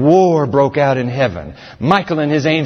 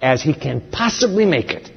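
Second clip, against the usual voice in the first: normal talking voice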